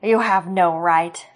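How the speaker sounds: slow, loud, snarling rebuke; snarled. Acted. Sloane snarling at Roran over his daughter, slow and loud. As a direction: snarl each word at him. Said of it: "Sloane glared at Roran and snarled".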